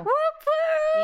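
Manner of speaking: high, bright whoop of celebration; excited cheer. Real, not acted. Celebrating birthday month.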